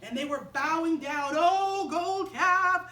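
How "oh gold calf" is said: loud, high, hammed-up praise of the idol; mock worship. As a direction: gush over the gold calf like fervent worshippers. People bow and worship.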